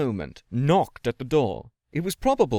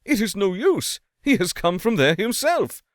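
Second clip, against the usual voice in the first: wailing; 7 semitones higher, 4 dB louder than usual. A narrator's reading. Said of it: "wailed the landlord".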